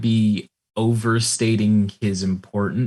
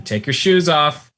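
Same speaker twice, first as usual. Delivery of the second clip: high, raised shout from another room; shouted order; 8 semitones higher, 5 dB louder than usual. Acted. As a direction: call it out from the kitchen. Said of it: "you hear a voice shout from the kitchen".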